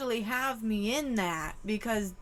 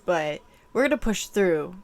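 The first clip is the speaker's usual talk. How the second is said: normal talking voice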